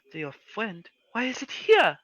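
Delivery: put-on high, worried old woman; grandma voice. Grandma questioning Anita about the hag, high worried voice. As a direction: fret like a scared granny.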